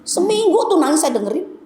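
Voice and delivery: ordinary delivery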